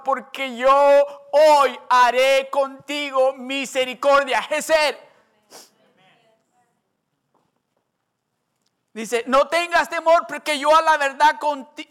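loud preacher proclaiming the promise; preaching at full force. Acted. (predicación fervorosa y fuerte)

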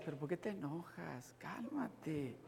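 calming voice. Retold or imitated. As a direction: imitate someone telling you to calm down. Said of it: "y alguien dice".

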